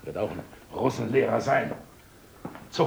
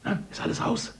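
hushed whisper; 7 semitones higher, 4 dB quieter than usual. Acted. Mother whispering to Lisa at the door, hushed. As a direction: whisper so the stranger can't hear. Hiding from the new lodger.

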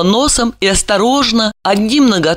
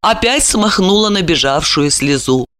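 ordinary delivery

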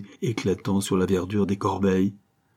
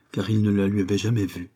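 ordinary delivery

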